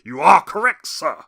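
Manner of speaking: hearty mock-announcer boom; booming sidekick catchphrase. Mimics Ed McMahon's catchphrase.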